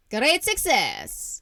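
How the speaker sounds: slow, drawn-out impression that starts high and falls; Borat. Trying out a character voice for the co-host, slow Borat impression. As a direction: put on a strong, slow foreign comic voice. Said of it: "let me try my Borat voice".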